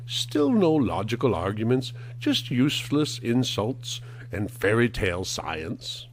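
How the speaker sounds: normal talking voice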